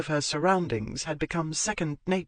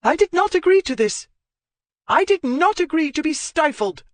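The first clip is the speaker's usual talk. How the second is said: much higher and louder, near shrieking outrage; voice up a notch; 7 dB louder than usual. Acted. Zoe escalating against Marchmont, much higher and louder. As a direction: push the voice up another notch in fury. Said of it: "Her voice went up another notch".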